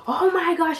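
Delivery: high-pitched mock outrage; mom going off. Mom hears the confession, high imitated outrage. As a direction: exclaim high, like a scandalized mother. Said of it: "she starts going off".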